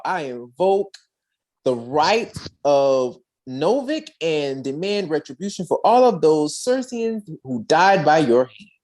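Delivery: ordinary delivery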